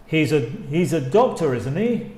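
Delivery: "isn't he?" rising at the end; genuinely asking; rising tag. Shown as a demonstration. Teacher repeating the rising question tag. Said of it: "Con rising intonation".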